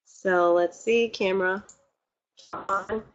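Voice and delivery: quiet self-talk checking the camera; muttered to herself. Real, not acted. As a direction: mumble it to yourself while fiddling with the camera.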